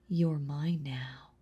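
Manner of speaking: slow, possessive whisper. Acted. Vampire claiming the hunter as hers, slow whisper.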